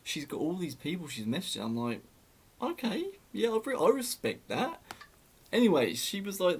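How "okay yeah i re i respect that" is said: high, sarcastic mock acceptance; sarcastic okay. Mocking her news to a friend in a high sarcastic voice. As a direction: sing it up high, pretending to approve.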